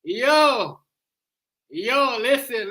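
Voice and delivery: loud, high yell cutting in; shouting at the screen. Real, not acted. Streamer yelling at a politician on screen, loud high outburst. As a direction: yell over the clip to shut him up.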